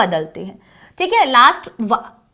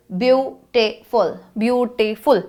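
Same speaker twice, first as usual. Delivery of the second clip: "beautiful" in three beats, beau-ti-ful; syllable by syllable; 3 semitones lower, 4 dB quieter, much slower than usual. Shown as a demonstration. Teacher counting syllables for the class, syllable by syllable. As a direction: separate each syllable of "beautiful" with small gaps.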